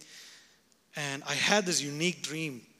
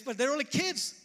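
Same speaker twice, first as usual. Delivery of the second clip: high incredulous questioning; lightly voiced skeptical self; doubting mind; 12 semitones higher, faster than usual. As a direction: voice his doubt in a raised pitch. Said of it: "fleshly mind says".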